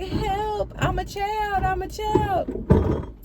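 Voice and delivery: very high, squeaky cry for help; fake child's voice. Mystery voice calling from behind double doors, high fake child voice. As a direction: put on a high, stretched-out child voice. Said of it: "So you hear this voice".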